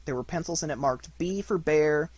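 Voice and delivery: usual speaking voice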